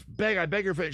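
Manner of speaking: high, urgent mock plea; mock begging. Wife on her knees to her husband, mock begging. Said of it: "And she begs his".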